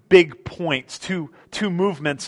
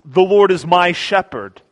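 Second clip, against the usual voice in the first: louder, certain declaration; great confidence; 3 semitones higher, 6 dB louder than usual. Pretend. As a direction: proclaim it with no doubt at all. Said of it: "he utters with great confidence".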